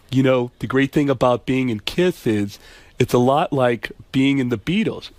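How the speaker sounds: [name]. Acted. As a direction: do a low-key rock star impression. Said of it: "where you hear [name] saying".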